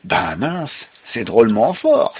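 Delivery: touch of surprised outcry; mild exclamation. A narrator's reading. Rip reacting to strong beer, mild exclamation. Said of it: "s'écria-t-il".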